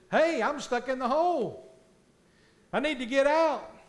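loud, high call up out of the hole; stuck man calls up to the passerby. Retold or imitated. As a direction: shout up from the hole.

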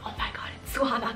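hushed, starstruck mock whisper; excited whisper. Spotting Suhana Khan while eating dessert, re-enacted whisper. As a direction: whisper it as if the star might overhear. Retold star sighting over dessert.

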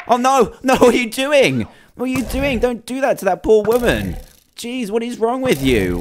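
high-pitched, hammed-up shock; mock outrage. Gamer scolds the beetle attacking the woman, in high mock outrage. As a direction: squeal at the bug like it's a scandal.

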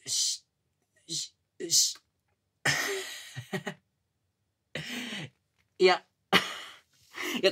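high giddy stammer into laughter; flustered laughing. Acted. Junior laughing giddily after senpai says yes. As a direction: stumble over words, laughing.

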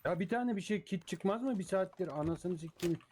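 high, exasperated whine; frustrated complaining. Real, not acted. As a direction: whine about the bad loot. Complaining about no kits.

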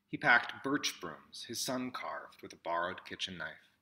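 ordinary delivery

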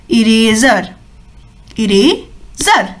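slow, low model of इरेजर; repeat after me. Shown as a demonstration. Teacher asks children to repeat इरेजर slowly. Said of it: "मेरे साथ बोलिए".

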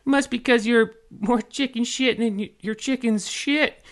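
very high, drawn-out taunt; taunting fairy. A narrator's reading. Tink mocking the pirate, high slow taunt. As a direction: jeer slowly and loudly in a high fairy voice. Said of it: "Tink taunted".

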